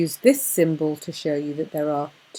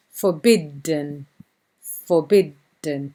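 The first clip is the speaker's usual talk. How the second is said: forbidden, slow, louder on the DD; double D stressed; 3 semitones lower, 3 dB louder, slower than usual. Shown as a demonstration. Teacher stressing the two Ds in forbidden. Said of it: "Two Ds".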